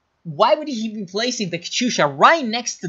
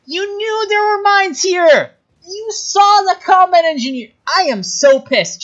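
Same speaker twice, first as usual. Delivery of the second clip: high furious yelling; angry shout; 8 dB louder than usual. Real, not acted. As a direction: shout your outrage at the player. Angry at player's mine blunder.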